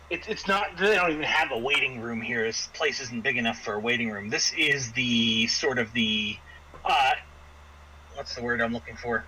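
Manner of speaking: ordinary talk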